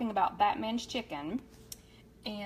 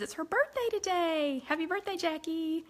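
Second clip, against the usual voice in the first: bright, much higher birthday greeting; light up and sing out the happy birthday; excited greeting; 8 semitones higher than usual. Real, not acted. Host wishing viewer Jackie happy birthday, high and excited.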